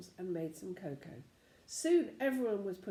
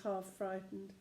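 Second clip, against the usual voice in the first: ordinary delivery, nothing marked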